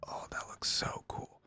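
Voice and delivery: barely audible whisper of admiration; awed whisper. Real, not acted. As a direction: whisper it to yourself in awe. Awed by the skin.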